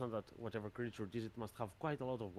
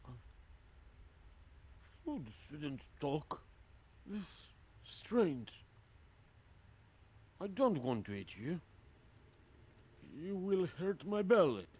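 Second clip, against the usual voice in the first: dim hill giant; 6 semitones higher than usual. Acted.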